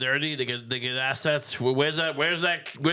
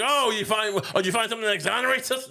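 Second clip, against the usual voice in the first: higher, eager mock questioning; 8 semitones higher than usual. Someone asking about the found memo, eager. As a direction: ask it keenly, pitched up.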